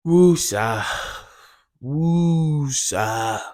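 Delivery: low, soft, drawn-out woosa; calming breath. Real, not acted. Telling himself to calm down after the rant, soft and slow. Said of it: "Let me try to calm myself down".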